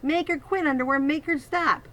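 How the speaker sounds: high, frantic yelling; boy's high, loud panic; panicked bully. Acted. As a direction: shriek at him to make her stop. Bully panics as sister bites.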